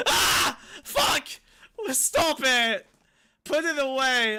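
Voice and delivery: high, loud shocked shouts; startled yells. Real, not acted. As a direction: yell in real alarm. Real startled reaction in room.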